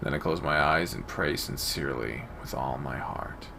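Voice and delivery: ordinary talk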